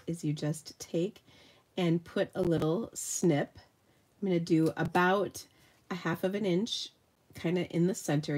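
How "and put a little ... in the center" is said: ordinary talk, nothing marked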